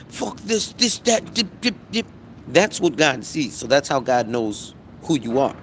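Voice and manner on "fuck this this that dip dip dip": higher, offhand mock cussing; everyday cussing talk. A person in their own everyday talk, higher and careless. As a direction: toss it off like casual street talk. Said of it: "when you're normally talking like".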